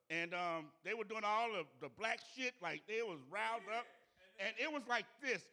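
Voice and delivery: loud riled-up telling. Real, not acted. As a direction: tell it like you're back in the crowd. Riled-up storytelling.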